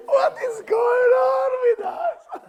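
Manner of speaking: very high, loud, drawn-out wail; over-the-top slow shriek; mock outcry. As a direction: shriek it slowly in mock despair. Mock outcry at group's answer.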